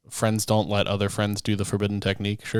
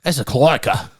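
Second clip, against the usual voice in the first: playful Australian accent, higher; 5 semitones higher, 4 dB louder than usual. Shown as a demonstration. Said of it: "cloaca in an Australian accent".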